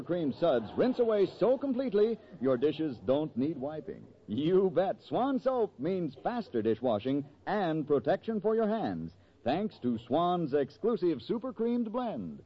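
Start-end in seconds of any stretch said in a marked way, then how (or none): none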